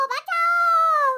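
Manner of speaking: put-on child crying for help after spilling turmeric; mock cry for help. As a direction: wail for help like a pretend victim. Kids spilled turmeric, call help.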